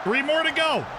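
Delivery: excited cheer. Real, not acted. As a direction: pump up the crowd. Celebrating goal.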